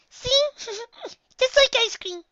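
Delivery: put-on high, loud kid voice with a giggle; gleeful giggling. A narrator's reading. Pony proving her point to a friend, gleeful giggle. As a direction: squeal triumphantly, laughing midway.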